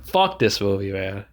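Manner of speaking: usual speaking voice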